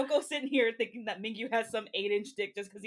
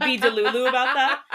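raised, louder, laughing voice; 3 semitones higher, 9 dB louder than usual. Real, not acted. Host teasing listeners, laughing and louder. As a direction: laugh through the end of the warning.

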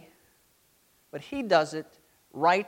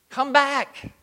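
louder, higher call as God the lion; 7 semitones higher, 5 dB louder than usual. Acted. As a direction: call the scattered people home loudly. God the lion calling people.

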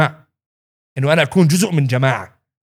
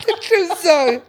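high laughing squeal of a line; laughing retelling; laughing quote; 14 semitones higher than usual. Retold or imitated. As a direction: blurt it out laughing. Quoting himself to his son.

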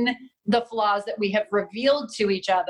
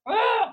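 sudden high fiery "Ah"; fiery outburst; 12 semitones higher than usual. Retold or imitated. Wife mimicking her own fiery outburst at partner. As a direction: flare up like a hot temper. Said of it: "I have a fiery Irish personality".

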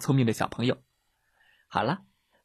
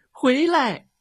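high, loud call; loud shout; 14 semitones higher, 7 dB louder than usual. A narrator's reading. Rabbit shouts after the leaving bear, loud call. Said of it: "兔子喊道".

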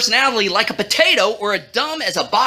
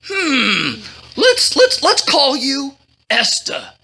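pitched-up voice, pausing to ponder; fuss, then think it through aloud; 4 semitones higher than usual. A narrator's reading. Cousin picks a safer name, fretful.

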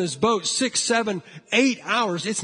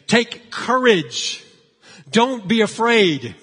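slow, raised-voice call, each word stretched; retold crying out; 3 semitones higher, 5 dB louder, slower than usual. Jesus to his disciples in the boat, crying out. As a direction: call it out firmly to frightened men. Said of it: "Jesus cries out".

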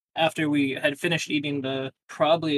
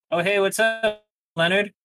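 bartender's greeting, pitched higher and brighter in tune; lightly voiced bartender, higher pitched; casual bartender greeting; 5 semitones higher than usual. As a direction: toss off "Oh, hey, what's up" high and breezy like a barkeep. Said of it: "the bartender was like".

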